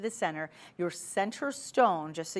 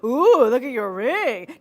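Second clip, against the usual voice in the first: much louder and higher, slow drawn-out squeal; the over-the-top way you shouldn't say it; loud gushing ooh; 5 semitones higher, 13 dB louder, slower than usual. Shown as a demonstration. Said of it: "You don't go".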